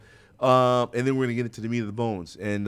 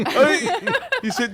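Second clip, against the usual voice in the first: laughing; 13 semitones higher, 4 dB louder than usual. Real, not acted. Laughing while teasing co-host.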